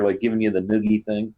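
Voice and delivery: ordinary delivery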